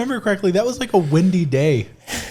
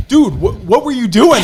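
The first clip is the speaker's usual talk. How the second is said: mock irritated uncle; 5 semitones higher, 6 dB louder than usual. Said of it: "he was just like".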